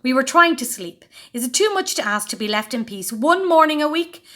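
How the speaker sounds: loud, high exasperated scolding. A narrator's reading. Furious Mum scolding.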